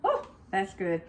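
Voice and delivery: savoring a sip of broth; tasting off spoon. Real, not acted. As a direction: mouth just off a tasting spoon. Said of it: "I'm gonna taste of this broth".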